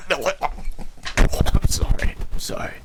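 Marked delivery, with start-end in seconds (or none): none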